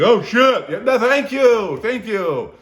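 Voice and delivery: high, loud, frantic thanks; panicked Jimmy. Retold or imitated. Jimmy waking to ants, loud panicked thanks. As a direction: yelp it out in panic.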